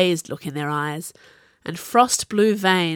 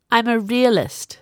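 higher, superior declaration; haughty; 5 semitones higher, 4 dB louder than usual. A narrator's reading. Viola justifying herself to Sage, haughty. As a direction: state it from above them. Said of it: "she said haughtily".

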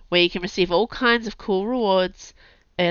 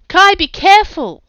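high, loud cry echoing from far above; distant shout; 13 semitones higher, 15 dB louder than usual. Acted. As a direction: call down in alarm. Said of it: "Camilla's voice echoed down to them".

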